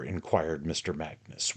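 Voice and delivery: usual speaking voice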